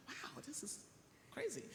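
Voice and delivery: high, breathy, astonished aside; stunned disbelief. Retold or imitated. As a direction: gasp it out in disbelief. Reacting to the patient, incredulous.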